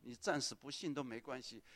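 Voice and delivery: normal talking voice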